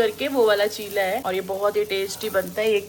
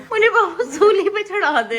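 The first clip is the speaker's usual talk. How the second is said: higher, louder hammed-up wail through laughter; mock wail; 8 semitones higher, 5 dB louder than usual. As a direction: play a fake tragic cry, laughing. Mock despair while being teased. (पति के ताने पर नाटकीय, हँसी भरा झूठा रोना)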